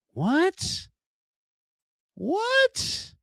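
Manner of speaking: squeaky, rising disbelief; a sudden high double take; surprised. Real, not acted. Host reacting to a chat handle, surprised high what.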